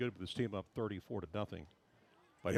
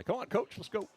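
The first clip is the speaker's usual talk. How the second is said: calling out; 11 semitones higher than usual. Real, not acted. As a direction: call him over eagerly. Calling coach to come over.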